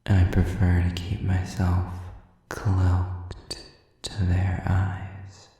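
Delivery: ordinary talk, nothing marked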